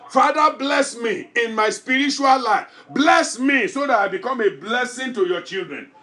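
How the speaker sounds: loud, pleading prayer at full force; fervent shouted prayer. Acted. Pastor praying aloud for the church, fervent and loud. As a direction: cry out to God with everything.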